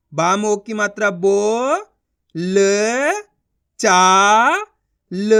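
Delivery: बो, ल, चा, ल separated by long pauses; syllable sounding out. Shown as a demonstration. Teacher spelling out for learners, sounding out. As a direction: hold बो, then give each letter alone. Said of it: "उनका उच्चारण करो".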